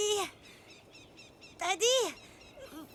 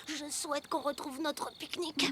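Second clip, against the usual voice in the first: hushed wish; 5 semitones lower, 7 dB quieter than usual. Acted. Making a wish on star.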